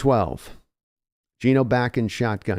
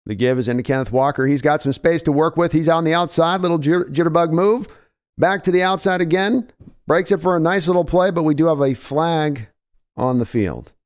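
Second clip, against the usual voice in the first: excited play call; 5 semitones higher than usual. Real, not acted. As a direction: call the run with rising excitement. Exciting run call, rising.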